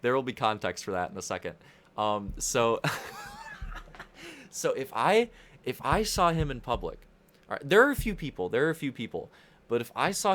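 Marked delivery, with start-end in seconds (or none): 2.8-4.5 high burst of laughter. Real, not acted.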